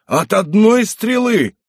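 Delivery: high, slow cry of disbelief; amazed exclamation. A narrator's reading. Zych marvelling at the single-arrow kill, amazed exclamation. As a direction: marvel aloud, drawing it out high. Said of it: "воскликнул Зых, подъезжая ближе".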